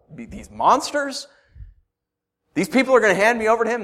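loud, high-pitched incredulous plea; betrayed disbelief. Acted. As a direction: protest as if you've just been betrayed. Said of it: "sense of betrayal that David's feeling".

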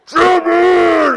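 huge, loud commanding bellow; retired cop roaring across the beach; booming cop shout. Retold or imitated. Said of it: "the voice you get when you are just, like, tougher than nails".

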